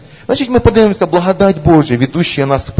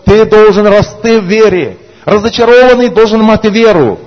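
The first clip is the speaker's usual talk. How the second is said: loud, raised, driving sermon voice; fiery preaching; 4 semitones higher, 4 dB louder than usual. Acted. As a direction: preach it hard to the congregation. Preaching at full force.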